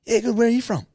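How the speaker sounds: high, bright-eyed mock seatmate voice; eager chatty stranger. Mocking a chatty seatmate on a flight, high and eager. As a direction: push it like an overkeen plane neighbour.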